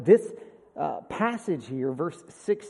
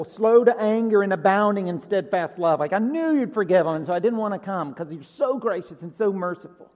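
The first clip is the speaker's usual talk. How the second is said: louder, exasperated whine at God; complaining Jonah; 2 semitones higher, 6 dB louder than usual. Retold or imitated. Jonah complaining to God about Nineveh, loud and exasperated. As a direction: grumble like a sulking prophet. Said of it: "It's like".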